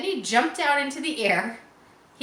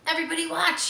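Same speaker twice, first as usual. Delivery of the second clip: cried out; 7 semitones higher, faster than usual. A narrator's reading. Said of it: "he cried".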